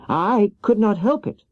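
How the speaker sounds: put-on little girl pleading for help; young Dorothy. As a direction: play Dorothy small and meek, pitched high.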